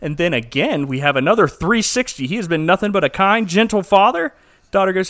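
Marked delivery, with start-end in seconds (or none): none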